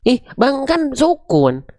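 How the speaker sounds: high-pitched pupil objecting; protesting student. Acted. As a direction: play a learner arguing back. Acts student protesting to teacher. (murid protes dengan nada tinggi)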